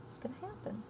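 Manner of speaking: secretive whisper. Acted. Teacher whispering a teaser to the students. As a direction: hush it like a secret.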